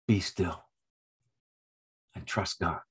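quiet, settling command; hushed reassurance. Acted. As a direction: speak gently as a calming voice in the storm. Voicing the Spirit speaking in storms.

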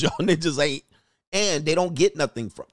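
ordinary delivery

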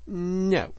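high-pitched, stubborn refusal; sullen but stout. A narrator's reading. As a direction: refuse a little sulkily but firmly. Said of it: "a little sullenly but stoutly".

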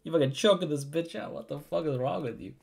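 high-pitched imitation of shocked outrage; mock appalled disbelief. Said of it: "Mingren is just like".

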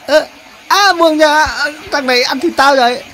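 high-pitched cry to be released; acted alarm. Spider crying out that the crab is eating it, alarmed. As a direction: cry out as a small creature being seized.

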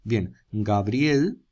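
Gabriel said very slowly and clearly; drawn-out model word. Shown as a demonstration. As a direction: stretch out Gabriel slowly for the pupils. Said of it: "Vamos a Gabriel".

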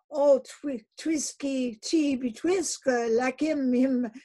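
high loud stammering search; faltering for a word. Acted. Queequeg falters searching for a word, high and loud. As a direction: grope hard for the word. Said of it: "faltering hard for a word".

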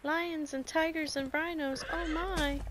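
playful singsong. Pretend. Quoting Wizard of Oz playfully.

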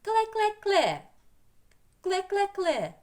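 high, loud, ringing "kle-kle-kle"; clear chirping bird call. Acted. Teacher voicing a riddle bird for children, high clear chirping. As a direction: chirp it out in repeated high bursts. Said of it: "Голоском речистим клече".

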